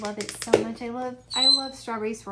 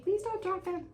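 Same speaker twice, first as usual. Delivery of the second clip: mock plea; 10 semitones higher than usual. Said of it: "I'm like".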